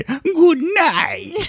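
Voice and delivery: "Good night" in high creepy kitten voice; cat voice. Acted. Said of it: "That's a pretty good cat voice".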